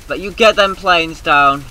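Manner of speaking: loud hammed-up command; shouting at the planes to come down; mock order. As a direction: bark it like a sergeant.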